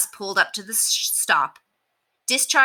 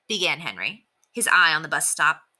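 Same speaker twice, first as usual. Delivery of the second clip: plain reading voice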